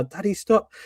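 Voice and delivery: a light take on a small daughter pleading; imitating his daughter. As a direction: mimic a little girl begging her dad. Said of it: "my daughter's going to me".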